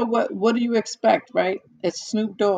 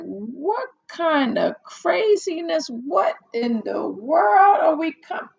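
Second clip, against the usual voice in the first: slow, higher outraged question; watching the movie, slow higher appalled question; shocked disbelief; 8 semitones higher, slower than usual. Retold or imitated. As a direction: stretch it out in disbelief. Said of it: "I'm like".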